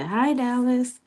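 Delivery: high, sing-out mock greeting; imitated greeting. People greeting the speaker's son, high imitated hello.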